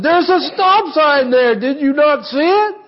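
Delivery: high, put-on scolding wife; wife's scolding voice. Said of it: "Laura said".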